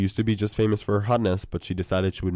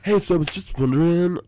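mock pickup line; 7 semitones higher than usual. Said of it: "That's how guys start".

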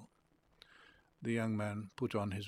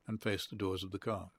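plain reading voice

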